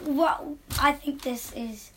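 hesitant attempt at a British accent. Shown as a demonstration. Son showing off for the podcast host, halting British accent. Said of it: "you have a good British accent".

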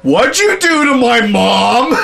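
high, loud, slow, laughing shout; barely holding in the laugh; mock outrage through laughter. Son confronting his boss about his mom, laughing. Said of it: "So I'm like".

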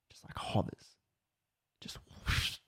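breathy hush as the note floats up; hushed whisper. A narrator's reading. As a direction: whisper it like a ghost story. Eerie moment the note floats up.

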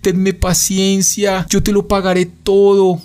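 kneeling debtor begs the king, high pleading voice; desperate pleading. A narrator's reading. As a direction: beg for mercy, voice lifted high. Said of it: "Juan de rodillas le suplicó".

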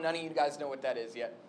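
ordinary talk, nothing marked

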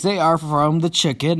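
ordinary talk, nothing marked

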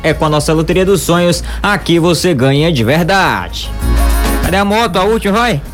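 hyped radio ad read; booming prize announcer. Acted. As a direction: sell the prize like a game-show host. Lottery ad read live.

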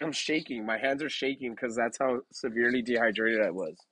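ordinary talk

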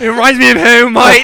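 loud, high mock Australian; hammed-up Aussie surfer accent. Mock Aussie surfer joking with mates, hammed-up Australian accent.

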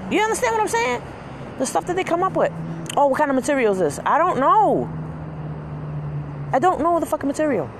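high-pitched fed-up ranting; worked-up venting; frustrated rant. Real, not acted. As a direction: vent about everything she asked. Ranting about customer questions.

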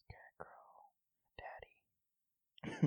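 breathy whisper of a quote. Retold or imitated. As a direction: whisper it, barely voiced. Quoting a creepy movie line.

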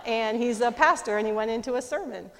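ordinary talk

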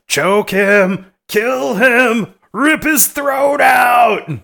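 high, loud, slow killer doll; evil doll shout. Retold or imitated. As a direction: scream orders like a possessed doll. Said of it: "the head is going".

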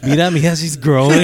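proud mom voice. Retold or imitated. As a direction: play a proud mom gushing. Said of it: "They're like".

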